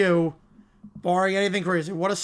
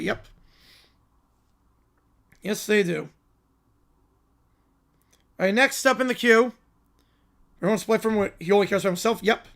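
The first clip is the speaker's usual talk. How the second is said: usual speaking voice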